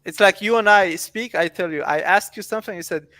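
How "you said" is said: ordinary talk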